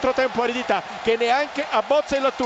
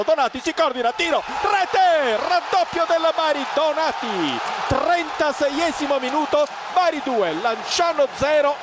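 excited goal call; 3 semitones higher than usual. Real, not acted. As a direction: burst out on the goal. Second goal called live. (telecronaca concitata del raddoppio)

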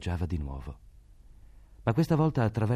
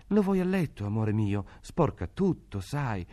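coaxing mother; 8 semitones higher than usual. A narrator's reading. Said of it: "si curvò su lui spaventata".

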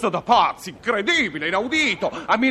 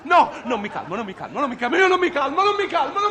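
rising higher, frantic I won't calm down; hysterical refusal; 6 semitones higher than usual. Acted. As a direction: wind yourself up, repeating it ever higher. Passenger losing his temper.

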